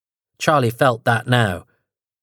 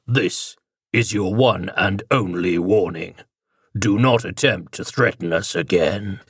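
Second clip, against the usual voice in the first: growling deeper voice; 2 semitones lower than usual. Acted. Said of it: "growling edge to it and a much deeper register".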